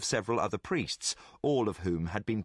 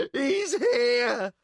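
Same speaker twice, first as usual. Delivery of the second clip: much higher, loud terrified shriek; 11 semitones higher, 6 dB louder than usual. Acted. Thief feels the dark power arrive, shrieking. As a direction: scream it out of control. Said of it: "shrieked Jack, out of control".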